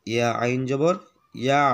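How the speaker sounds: ordinary talk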